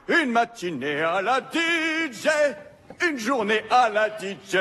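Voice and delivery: radio DJ. Acted. DJ jingle performance.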